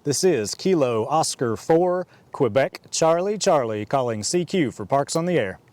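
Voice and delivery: ordinary delivery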